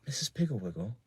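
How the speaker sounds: low voice. A narrator's reading. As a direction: keep it down from the children. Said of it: "said in a low voice".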